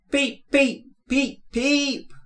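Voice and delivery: high beeping whistle call; whistling engine. A narrator's reading. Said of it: "whistled Thomas".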